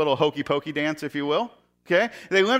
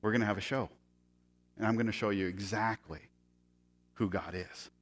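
hushed promise; 8 semitones lower, 11 dB quieter than usual. Retold or imitated. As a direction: lean in and lower the voice.

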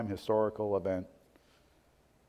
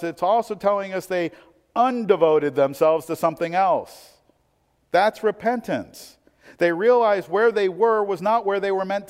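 raised, higher-pitched sermon voice; impassioned preaching; 8 semitones higher, 7 dB louder than usual. Real, not acted. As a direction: build to an emphatic, louder appeal.

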